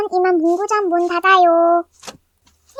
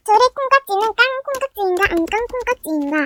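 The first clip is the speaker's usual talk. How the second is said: fast tongue twister; 2 semitones higher than usual. Acted. Kid racing a tongue twister, rattled off fast.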